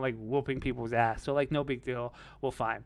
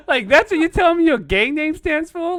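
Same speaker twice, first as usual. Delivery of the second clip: louder, higher, laughing through the words; laughing disbelief; 13 semitones higher, 13 dB louder than usual. Real, not acted. Telling friends about Snaps, laughing incredulously. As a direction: laugh while mocking the gang name.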